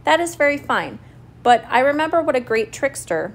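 crafty, lightly teasing tone; sly grin. A narrator's reading. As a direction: say it slyly through a grin. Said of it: "said with a sly grin".

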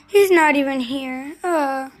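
ordinary talk, nothing marked

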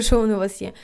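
usual speaking voice

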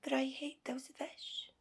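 high, whispery confession; hushed loathing. Acted. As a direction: drop to a tense near-whisper. Wife confides her hatred.

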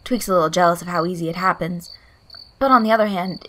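ordinary delivery

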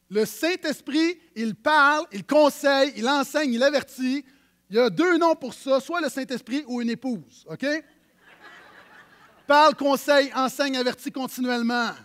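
loud, insistent preaching, words hammered out; emphatic preaching. Acted. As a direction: drive each verb home to the congregation. Preaching at full force.

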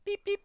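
high squeaky beep beep; playful electronic beeps; mock beep. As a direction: chirp it like a machine. Mimicking keycard beep.

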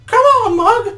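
loud, very high cartoon falsetto; Cuphead. Frightened brother urges the other to flee, high squeal. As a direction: squeal it urgently to hurry someone along. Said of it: "panted Cuphead".

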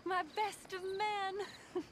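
tender adoring. Acted. Woman greeting her sweetheart at the station, tender. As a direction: savour each word for him.

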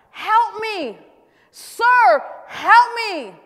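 high, loud cry for help; pleading shout. Acted. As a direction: holler for help at full voice. Acted Canaanite woman's cry for help.

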